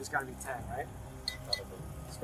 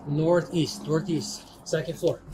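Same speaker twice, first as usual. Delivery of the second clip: normal talking voice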